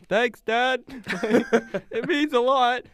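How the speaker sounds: high-pitched mock-touched son; grateful son. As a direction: ham up being moved by Dad's praise. Plays the praised son replying.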